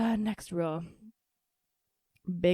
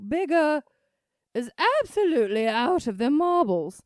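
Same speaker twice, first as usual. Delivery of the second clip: put-on Julia Child; 5 semitones higher, 5 dB louder than usual. Reading a found quote as Little Edie, slipping into Julia Child. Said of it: "that was definitely Julia Childs".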